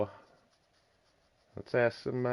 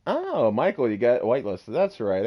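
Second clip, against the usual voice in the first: excited greeting; 4 semitones higher, 9 dB louder than usual. Real, not acted. Greeting a friend joining the server.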